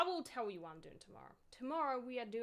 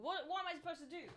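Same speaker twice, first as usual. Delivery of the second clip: ordinary talk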